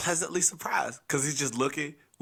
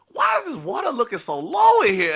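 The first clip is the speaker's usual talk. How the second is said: puzzled grandpa; 8 semitones higher, 7 dB louder than usual. Retold or imitated. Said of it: "The first thing he says".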